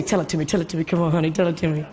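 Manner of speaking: low, soft coaxing plea; curled up begging for a story; cozy plea. Retold or imitated. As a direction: beg softly like a child at bedtime.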